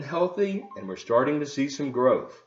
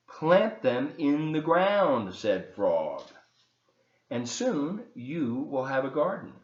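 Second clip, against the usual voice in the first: put-on reading to children; 3 semitones lower than usual. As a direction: read it like bedtime for little kids. Reads Frog and Toad to children.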